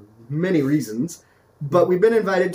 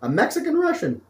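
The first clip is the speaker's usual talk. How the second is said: mock incredulous; 11 semitones higher than usual. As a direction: sound amazed at the absurdity.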